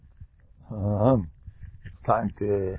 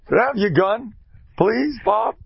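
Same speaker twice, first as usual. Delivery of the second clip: higher, louder mock urging; joking gun line; 12 semitones higher, 6 dB louder, slower than usual. Rabbi teasing Bob about killing the kids, mock urging. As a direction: ham it up as a silly threat.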